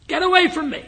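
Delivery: high, loud strained cry; retold cry with all his strength. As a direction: force it out with the last of his strength. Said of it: "he mustered all the strength".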